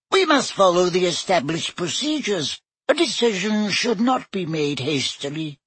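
high squeaky professor voice; a tiny wizard squeaking up; squeaky little voice. A narrator's reading. Flitwick to the staff, squeaky little voice.

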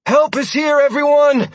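high loud call across battle; tired calling out. A narrator's reading. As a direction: call out to everyone, worn out. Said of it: "Sierra's tired voice rang out".

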